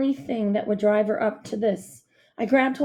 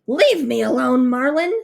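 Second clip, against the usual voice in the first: louder, angry snarl; 4 semitones higher, 6 dB louder than usual. A narrator's reading. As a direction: growl at the boy to back off. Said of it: "she snarled".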